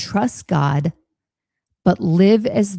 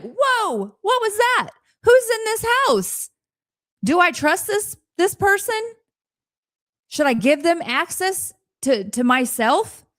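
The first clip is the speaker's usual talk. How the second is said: much higher, startled whoa and wary questions; startled alarm; 11 semitones higher than usual. Pretend. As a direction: jump at a stranger's voice in the house. Reacting to a strange voice at home.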